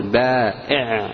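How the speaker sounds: بائع stretched slowly with a clear hamza; slow teacher model. Shown as a demonstration. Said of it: "تقول".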